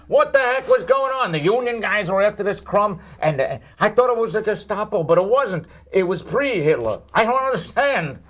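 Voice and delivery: high-pitched, baffled grumbling; exasperated comic rant. Pretend. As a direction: rant in mock bewilderment. Comic rant about confusing late movie.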